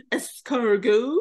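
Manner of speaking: slow, drawn-out mock French; imitating a bad French order; foreign accent in French. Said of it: "he'd go to the restaurant".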